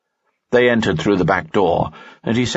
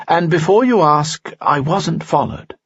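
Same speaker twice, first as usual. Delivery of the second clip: higher, courtly nobleman voice; a charming noble, pitched up; Lanson's voice; 10 semitones higher, 3 dB louder than usual. Acted. Lanson speaks in story.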